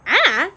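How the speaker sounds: short, loud yelp of a scream; loud startled "ah". A narrator's reading. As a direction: answer a fright with a loud scream. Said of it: "screamed the girl".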